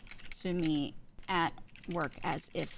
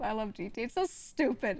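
normal talking voice

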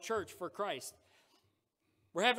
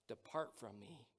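hushed solemn quote; 8 semitones lower, 12 dB quieter than usual. Acted. God's words to false followers.

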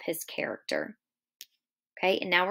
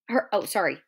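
plain reading voice

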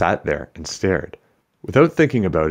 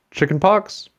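sudden high worried question; alarmed question; 13 semitones higher, slower than usual. Acted. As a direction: blurt it out worried. Anxious guess.

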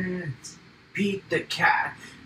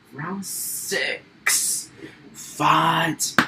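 ordinary delivery

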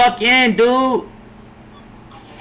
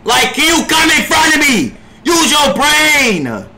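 higher, louder, fed up; exasperated yell; 8 semitones higher, 3 dB louder than usual. Real, not acted. Streamer scolding his teammate for bad positioning, exasperated yell. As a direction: scold the teammate at full volume.

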